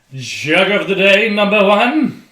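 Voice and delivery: loud, slow hammed-up announcement; announcer voice. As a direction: boom it out like a game show host. Announcing the beer chug.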